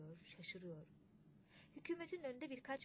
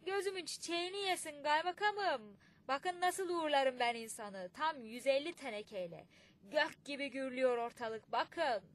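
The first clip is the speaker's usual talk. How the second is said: loud, higher gloating yell; boastful mocking shout; 5 semitones higher, 10 dB louder than usual. A narrator's reading. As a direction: crow over the tin-can send-off. Murtaza Ağa boasting, mocking farewell. (alaycı, böbürlenen bağırış)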